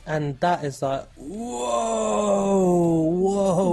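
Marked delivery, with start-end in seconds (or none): 1.2-3.7 mock awe. Co-host reacting to mysterious powers, drawn-out mock awe. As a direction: stretch it out in exaggerated amazement.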